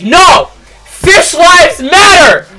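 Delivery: a furious, stretched-out yell at a crowd; mock protest shout. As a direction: shout it high and loud like an outraged protester. Woman barges in protesting.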